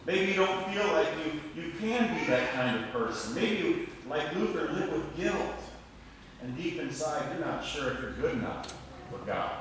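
ordinary delivery

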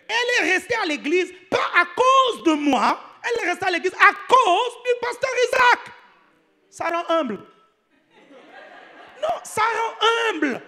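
high, loud, emphatic preaching shout; impassioned outcry. Real, not acted. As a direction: belt it out high in comic exasperation. Exclaims she stayed for another pastor.

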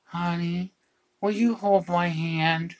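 high, slow, drawn-out plea; stretched-out, sing-song request in a high voice; pleading wife. Retold or imitated. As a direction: imitate a wife sweetly begging in labor. Acting wife's plea during labor.

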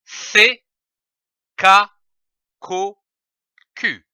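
C as K, then Ko and Q, each sound isolated; sound drill. Shown as a demonstration. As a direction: tap out K, Ko and Q for a mirror. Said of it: "en prononçant les sons".

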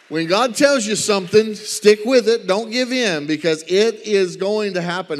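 fervent sermon climax; preaching at full force. Real, not acted. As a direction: preach it hard to the crowd. Preacher exhorting the congregation.

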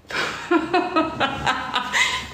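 high breathy laugh out of disbelief; real laughing fit; burst of laughter. Real, not acted. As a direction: laugh at the absurd memory. Laughing at the memory.

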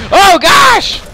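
very high, loud outraged yell; furious shout. Real, not acted. Player attacked while looting corpses, furious very loud yell. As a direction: scream it at the one who hit you.

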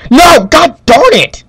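high, loud outburst of exasperation; real high-pitched exclamation; frustrated yell. Real, not acted. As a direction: cry out at a sudden setback. Game setback, yells.

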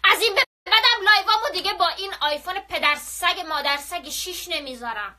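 loud, high outburst at the phone; annoyed rant. Real, not acted. Vlogger fed up with her old iPhone, loud rant.

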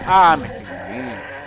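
loud, slow dismayed cry; frustrated groan. Real, not acted. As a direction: groan at dying. Gets killed.

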